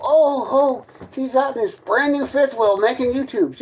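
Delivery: high, sing-song mock of his critics. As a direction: whine it like the jealous haters. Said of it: "You're the ones out here on this whole".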